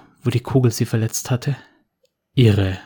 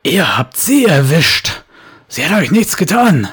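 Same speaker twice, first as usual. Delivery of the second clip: bellowing; 6 semitones higher, 4 dB louder than usual. Acted. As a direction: roar it at her. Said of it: "Tom brüllte sie an".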